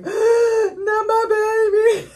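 high, slow, hammed-up anguished wail; mock wail. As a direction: cry out as if a favourite character is dying. I'm just like.

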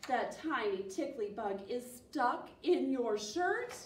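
ordinary delivery

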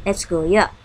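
ordinary talk, nothing marked

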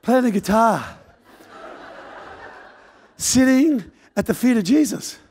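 normal talking voice

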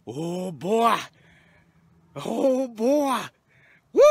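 high, rising excited whoops; real thrill. Real, not acted. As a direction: burst out cheering the catch. Celebrating new personal best.

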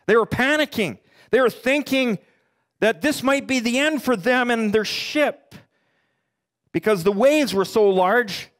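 impassioned sermon build; preaching at full force. Acted. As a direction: drive the danger home to the congregation. Building to the doomed boat.

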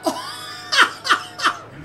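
high, delighted cackle; excited laughter. Real, not acted. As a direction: burst out laughing with glee. Thrilled at getting the car.

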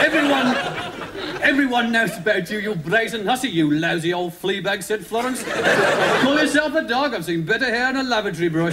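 high, indignant mock-children's-story insults; quarrelling story characters. Dougal and Florence trading insults, indignant.

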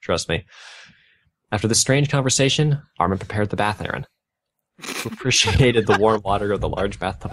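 deadpan dramatic fanfiction reading; mock fanfic reading. Podcaster reading fanfiction to cohost, deadpan storytelling. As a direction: read the bad fanfic aloud with a straight face. Reads fanfiction aloud, mocking.

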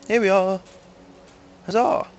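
high, pleased announcement; cheerful arrival. Real, not acted. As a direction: announce the arrival with a little flourish. Arrives at the new spot.

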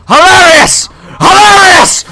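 high, loud sarcastic shout; exasperated full-voice yell; screaming. Real, not acted. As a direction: scream it out, escalating in pitch. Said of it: "then I can scream louder".

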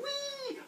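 very high squeal of flight; gleeful "whee". Acted. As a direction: squeal as if sailing through the air. Brother flung off a mountain.

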